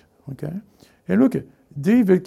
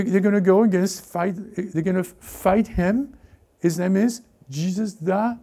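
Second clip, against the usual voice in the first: ordinary talk, nothing marked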